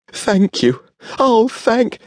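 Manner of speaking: high, rushed gratitude; gushing thanks. Acted. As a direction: gush thanks until cut off. Overjoyed thanks, cut short.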